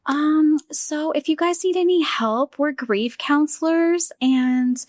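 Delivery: a grieving sister mocks the counselors who barged in, high and syrupy. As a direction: parody a too-eager grief counselor, up high. Mocking the grief counselors' pitch.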